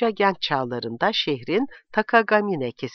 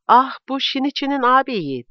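high, louder exclamation of recognition; excited cry; 9 semitones higher, 5 dB louder than usual. A narrator's reading. Chieko rising as she spots Shinichi's brother, crying out. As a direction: call it out high and loud, as narrated. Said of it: "diye bağırdı".

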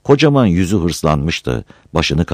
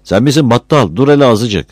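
a lightly voiced woman, higher and louder; mildly raised woman's voice; 8 semitones higher, 5 dB louder than usual. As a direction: call back from inside the house. Woman answers from inside.

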